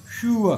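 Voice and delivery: शुअ said high and loud without r; modelling sure. Shown as a demonstration. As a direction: stress शुअ, drop the r. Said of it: "ये बहुत interesting है".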